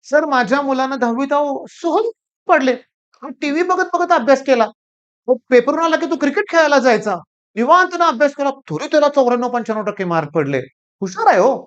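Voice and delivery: lightly voiced boastful father, up in pitch; proud parent quoted. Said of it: "त्यांचं म्हणणं काय होतं".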